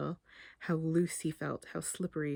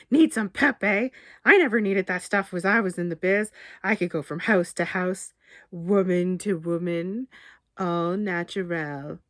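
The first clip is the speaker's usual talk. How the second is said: bragging blowhard; 6 dB louder than usual. Acted. Barry boasts to Damien about the old days, sly. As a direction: brag like a smarmy ex-charmer.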